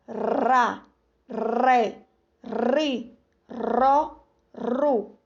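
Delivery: ra, ré, ri, ró, ru with a soft tapped R; soft R syllables. Shown as a demonstration. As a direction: drill the soft R syllables gently. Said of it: "é um som mais brando". (sílabas com R brando)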